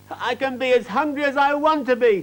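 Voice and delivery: put-on high, stubborn child's declaration; defiant little girl. A narrator's reading. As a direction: announce it like a spoilt girl who won't budge. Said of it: "declared Fifi".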